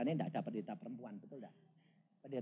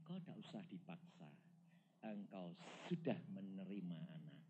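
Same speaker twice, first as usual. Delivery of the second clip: quiet fatherly Lord; tender voice of God; 6 semitones lower, 3 dB quieter than usual. Retold or imitated. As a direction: comfort her gently as a father. God answers gently.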